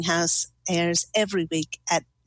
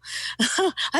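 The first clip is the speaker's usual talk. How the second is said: delighted giggle; 10 semitones higher than usual. Real, not acted. Delighted by the reading.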